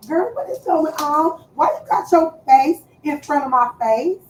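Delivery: high, loud mock concern; teasing. Real, not acted. Teasing her daughter who stares at her face, mock-concerned. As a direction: poke fun with fake worry.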